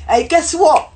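high, loud, eager call; excited greeting. Retold or imitated. Bubbly guy calling to a girl on the train, excited. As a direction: pipe up brightly to get attention.

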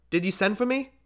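put-on high small boy asking; lightly voiced little boy; child voice. A narrator's reading. Small boy asks father.

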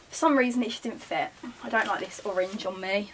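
usual speaking voice

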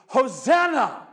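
called out high and loud; crowd's cry. Acted. Preacher voicing the crowd's cry, loud. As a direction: cry it like the Palm Sunday crowd.

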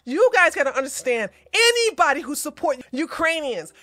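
heated, rising rant; angry condemnation. Real, not acted. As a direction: lay into them, building to the final insult. Scolding viewers who support them.

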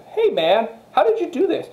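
high, excited question to the expert; eager asking. Retold or imitated.

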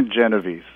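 Genovese said JEN-uh-veece, three syllables; ending in -veece. Shown as a demonstration. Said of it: "the easiest way of saying it".

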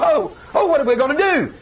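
high, worried outcry; mock panic. Israelites fretting at the Red Sea, mock panic. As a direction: wail it like a flustered crowd.

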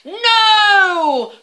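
very loud, high roared no; the dragon roars back at hedgehog. Acted. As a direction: bellow it. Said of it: "roared the dragon".

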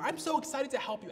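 high, loud, gushing eagerness; mock enthusiasm. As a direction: put on an over-keen helper's tone. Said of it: "I didn't have this great tone".

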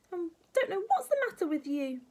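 put-on high, loud crabby old woman; scolding grandma. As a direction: scold as a cross granny.

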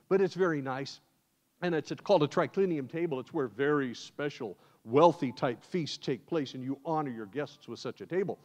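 ordinary talk, nothing marked

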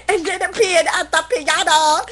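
ordinary talk, nothing marked

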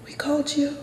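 high whisper with no voice; whispered plea to the cop; frightened whisper. Retold or imitated. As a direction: breathe it out in fear. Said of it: "And I said".

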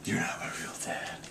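put-on whispered sulky kid retort; whispering. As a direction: hiss it like a pouty child. Mock sulky child retort.

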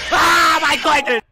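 laughing shout after a scare; nervous laugh and yell; panicking gamer. Real, not acted.

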